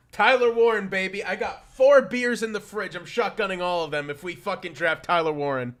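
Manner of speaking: high and loud, fired up; hyped up. Real, not acted. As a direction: pump yourself up for the pick. Hyped about the draft.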